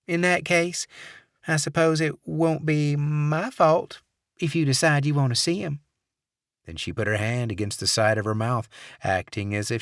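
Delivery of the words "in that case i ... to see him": higher coy Southern lady; sly sweet Southern offer; Southern accent. Acted. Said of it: "saccharine Southern accent".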